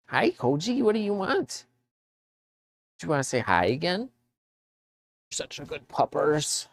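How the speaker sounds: very high sing-song dog talk. Real, not acted. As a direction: coo excitedly at the puppy. Greeting the dog.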